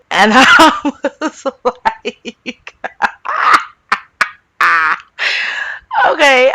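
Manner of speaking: laughing through the words, slow and high; helpless laugh, then a shouted high okay; real laughter. Real, not acted. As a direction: crack up and squeeze out okay. Cracking up recalling the moment.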